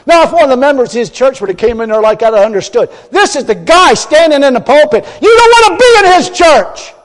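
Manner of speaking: loud, high revival preaching; fiery preaching. Acted. Preacher mocks the minister to the congregation, loud and fiery. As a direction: thunder it from the pulpit.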